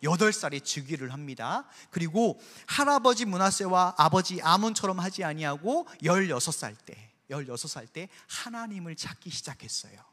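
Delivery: ordinary talk, nothing marked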